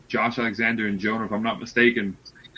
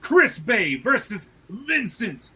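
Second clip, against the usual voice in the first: high, loud, drawn-out ring announcer call; fighting game announcer; 11 semitones higher, 5 dB louder, slower than usual. Pretend. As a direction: stretch each name like a fight intro. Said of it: "I got my announcer voice ready".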